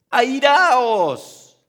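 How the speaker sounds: "Airaos" called out high and loud; proclaimed scripture. Shown as a demonstration. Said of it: "la Biblia dice". (proclamación fuerte de "Airaos")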